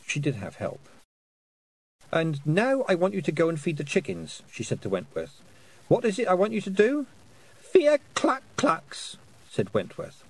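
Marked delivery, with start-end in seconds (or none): 2.1-7.1 put-on higher, carefully put question to a child; bossy instruction. A narrator's reading. Sister instructing small child. 7.6-9.2 put-on very high, slow toddler voice; small child. A narrator's reading. Small child answers sister.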